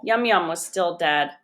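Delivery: usual speaking voice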